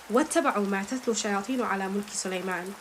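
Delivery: quick Quran recitation; rapid Arabic verse reading. Shown as a demonstration. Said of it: "I start reading this verse".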